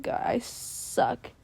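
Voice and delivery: slow defeated whisper; whispered self-scolding. Retold or imitated. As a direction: breathe it out, deflated. Retold self-criticism while crying.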